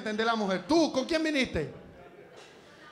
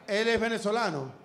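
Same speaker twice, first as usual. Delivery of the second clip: ordinary talk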